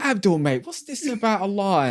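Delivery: imitating a cockney builder, voice jumping high; cheeky cockney bloke calling out; mock cockney. A builder on site teasing Abdul in a high mock cockney voice. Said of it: "the most cockney guy is like".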